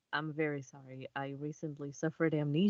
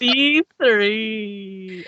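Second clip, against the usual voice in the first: laughing; 4 semitones higher, 11 dB louder than usual. Real, not acted. Player joins laughing.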